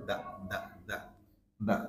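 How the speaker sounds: THE as a short "duh"; weak form. Shown as a demonstration. Teacher demonstrating weak "the" to learners, weak form. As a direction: say "দা" briefly and softly, neutral vowel. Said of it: "উইক sound হিসেবে".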